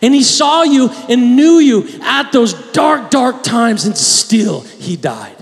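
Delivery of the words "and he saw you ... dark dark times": loud, high, impassioned preaching; fiery sermon climax; preaching at full force. Acted. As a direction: build to a fervent shout.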